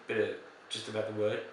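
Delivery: ordinary delivery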